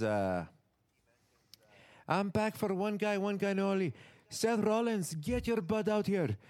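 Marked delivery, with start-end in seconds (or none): 2.1-6.4 high-pitched imitation of a wrestler's accent; Finn Balor speech. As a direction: call out a rival in a put-on, raised voice. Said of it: "He cuts the speech".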